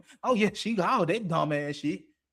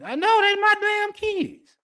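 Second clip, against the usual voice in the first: high, loud, drawn-out retort; exasperated parent; 12 semitones higher, 5 dB louder, slower than usual. Acted. Acted exasperated parent retort about kids.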